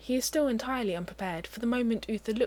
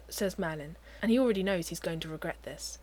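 ordinary delivery, nothing marked

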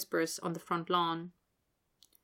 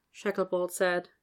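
plain reading voice

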